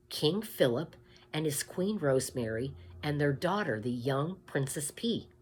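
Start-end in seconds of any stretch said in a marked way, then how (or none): none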